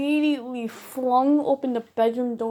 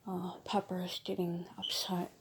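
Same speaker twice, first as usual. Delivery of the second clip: breathy hushed whisper, much softer; real whisper; 3 semitones lower, 12 dB quieter than usual. Real, not acted. Whispering so her upset bird Pepper can settle. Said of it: "I have a loud-ass mouth".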